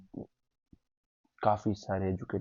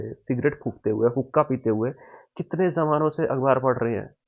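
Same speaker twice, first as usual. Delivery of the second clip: worked-up outburst; 6 semitones higher, 8 dB louder than usual. Real, not acted. Excited rant about elders reading newspapers.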